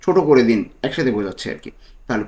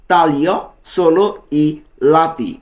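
very slow "taglio solo i lati", words drawn out; slow model phrase; much slower than usual. Shown as a demonstration. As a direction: dictate it slowly to the class. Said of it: "তো এর Italian কী হবে?".

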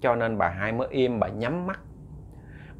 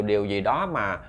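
ordinary delivery

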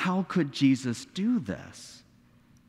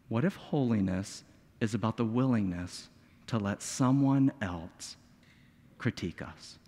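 hushed sermon questions; 7 semitones lower, 5 dB quieter than usual. Real, not acted. Preacher asks the congregation what holiness is, hushed. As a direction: draw the room in with a whisper. Closing questions, dropping to whisper.

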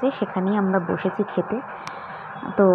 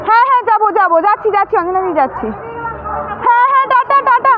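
excited calling out; 13 semitones higher, 7 dB louder than usual. Real, not acted. Calling goodbye while leaving.

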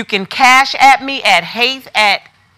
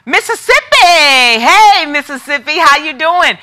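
excited greeting; 5 semitones higher, 3 dB louder than usual. Real, not acted. Greeting a viewer from Mississippi.

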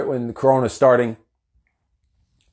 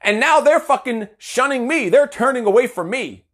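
exasperated rant; 7 semitones higher than usual. Retold or imitated. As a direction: vent the frustration at being shunned. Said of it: "I'm like".

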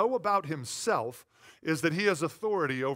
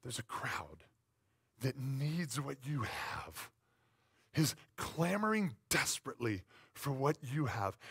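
hushed; 3 semitones lower, 7 dB quieter than usual. Acted. Hushed sermon aside.

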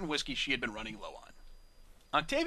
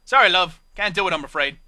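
loud, rough British barmaid voice; blunt British bar mare refusal; rough British accent; 3 semitones higher, 13 dB louder than usual. Acted. Bar mare refusing Octavia, rough British accent. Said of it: "The mare's rough, British accent".

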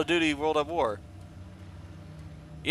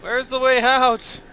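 pleading shout; 9 semitones higher, much slower than usual. Real, not acted. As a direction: yell for a way out. Calling out, stuck in the game.

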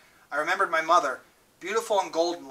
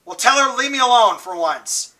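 higher, louder plea that cracks; voice breaking; 6 semitones higher, 6 dB louder than usual. A narrator's reading. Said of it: "His voice broke".

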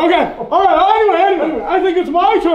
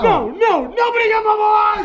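angry refusal; 4 semitones higher than usual. Acted. Angrily forbidding help for Bubble.

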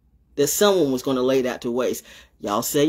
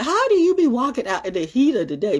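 high-pitched mock questioning; imitating questioners; 8 semitones higher than usual. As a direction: mimic nosy questioners in a higher voice. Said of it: "They were saying, like".

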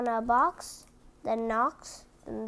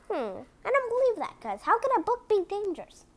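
quick, higher scoffing disbelief; skeptical; 8 semitones higher, much faster than usual. Acted. Reader doubting the book, fast scoff. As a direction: brush the warning off, fast.

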